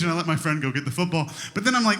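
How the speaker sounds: usual speaking voice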